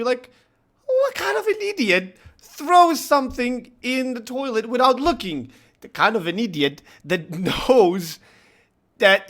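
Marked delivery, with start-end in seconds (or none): 0.9-5.5 higher, lightly voiced wife; a hint of the wife mocking him; wife teasing. Wife teasing husband about the splash, lightly voiced. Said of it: "she asked me".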